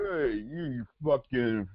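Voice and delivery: high, drawn-out Italian-American cursing; Italian-American accent. Acted. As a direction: start to curse like a Bronx Italian. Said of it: "Can't do an Italian accent without e".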